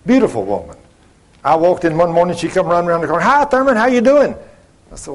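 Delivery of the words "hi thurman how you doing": high, bubbly hello; cheery greeting. Retold or imitated. Radiant coworker greeting the speaker one morning, cheery and high. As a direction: imitate an eager, high-pitched greeter.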